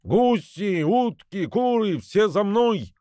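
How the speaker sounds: high calling out to the birds; rallying goose shout; gander's call. A narrator's reading. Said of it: "закричал".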